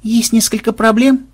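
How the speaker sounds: high, careful, polite objection; tactful. A narrator's reading. Melissa cautioning the excited crew, tactful remark. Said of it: "тактично заметила Мелисса".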